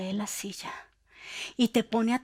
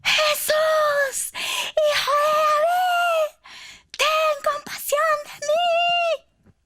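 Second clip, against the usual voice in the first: very high, loud, slow crying shout; desperate shout; 5 dB louder, much slower than usual. Acted. Blind beggar shouting even louder to Jesus, desperate. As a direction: scream it louder, voice cracking. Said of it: "Él gritó aún más fuerte".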